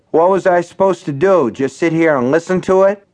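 put-on a drunk demanding his due; aggrieved barfly demanding. A narrator's reading. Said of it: "he demanded of Henry".

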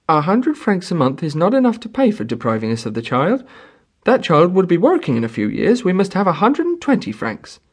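high, greedy bargaining voice; haggling peasant woman. A narrator's reading. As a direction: drive a hard bargain.

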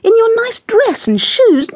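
high-pitched, scandalised fussing; shocked lady, pitched right up; crying out. A narrator's reading. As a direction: exclaim in fussy disbelief. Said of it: "cried Missus Tweedy".

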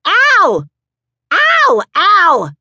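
very high, loud repeated screams; screaming Al. Acted. Mother pulling her husband off their son, screaming. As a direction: scream him off the boy. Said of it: "Moms screams".

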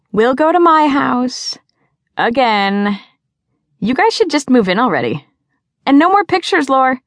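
put-on high, bright girl voice; bubbly teen girl. A narrator's reading. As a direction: play the dramatic best friend. Abby's voice, high and loud.

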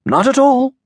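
high, lightly voiced priest; the priest, pitched well up. A narrator's reading. The priest answers.

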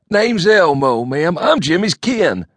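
high, loud, slow country bumpkin; bucolic drawl. A narrator's reading. Elmo telling his cousin the news in a loud bumpkin voice.

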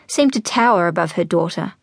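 usual speaking voice

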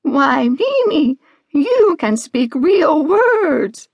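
high, slow, drawn-out amazement; astonished sheep stretching out each word; Mabel. Acted. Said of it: "were so surprised".

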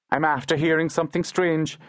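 put-on high, hurried boy's voice; hasty young boy. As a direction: explain it quickly as a young lad. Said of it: "Ned explained hastily".

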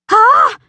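short high, loud startled scream. Acted. Mary sees a sheep slide under the door, startled shriek. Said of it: "screams Mary".

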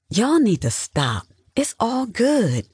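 high, drawn-out brush-off; unamused. A narrator's reading. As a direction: shut the joking down. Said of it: "not finding any humor".

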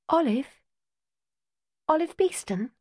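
put-on hopeful girl asking a stranger; young Jess. A narrator's reading. Jess voiced higher.

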